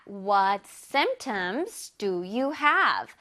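very slow, careful English; slow teacher demo; slow model reading. Shown as a demonstration. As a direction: spell out each word slowly for students. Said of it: "문장 다시 해볼게요".

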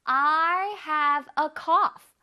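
very slow, drawn-out reading; slow model sentence. Shown as a demonstration. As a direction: stretch the words for the class. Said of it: "해볼게요".

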